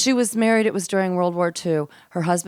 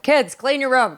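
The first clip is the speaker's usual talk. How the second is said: higher-pitched mock mom order; parent calling out; 7 semitones higher than usual. Mom to her kids about their room, mock scolding.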